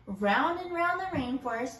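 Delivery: ordinary delivery, nothing marked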